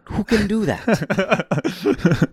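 high, very slow, stretched-out disbelief; who could even do that, high and drawn out; amazed disbelief. Real, not acted. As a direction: marvel at it, dragging every word out. Marveling at the pencil kill.

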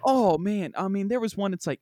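ordinary talk, nothing marked

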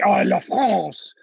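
slow, drawn-out Oh, la France; mock French. Shown as a demonstration. Showing how he would say France, slow mock French. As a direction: savor Oh, la France slowly, in a French way. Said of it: "but I could say".

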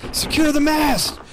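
very high, loud bellow over the storm; shouted orders. Acted. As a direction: roar commands over the wind. Bosun orders crew into storm.

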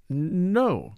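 hesitant, drawn-out denial; cautious. A narrator's reading. Commissary answering the angry millionaire, cautiously. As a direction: stall on the first sound, careful to commit. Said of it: "said the commissary cautiously".

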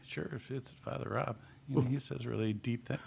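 unvoiced joking whisper; whispered aside. To the men's group, a teasing whisper. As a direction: drop to a secret whisper.

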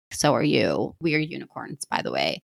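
a quiet, intimate throwaway to the listener; soft aside. Real, not acted.

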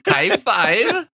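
lightly done Borat, rising up high on high five; a hammed-up Borat quote; Borat impression. Mocking his friend's fake German by quoting Borat, hammed-up. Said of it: "now you're Borat".

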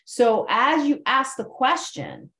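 normal talking voice

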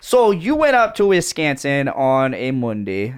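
hammed-up Midwest Wisconsin twang; Wisconsin accent. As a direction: play it like a cheery Wisconsin local. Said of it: "in a Wisconsin accent".

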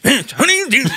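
high, overdone posh British attempt; posh British accent. Student retries the software's phrase, hammed-up posh British accent. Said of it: "Posh British accent".

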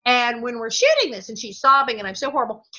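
ordinary talk, nothing marked

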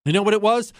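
normal talking voice

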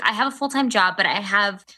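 usual speaking voice

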